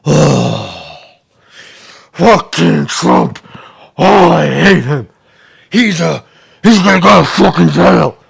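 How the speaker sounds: loud, slow, hammed-up disgust at Trump; mock angry hater. Mocking half the country hearing Trump, hammed-up loud rage. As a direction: spit it like an outraged hater. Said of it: "they go like this when they hear Trump".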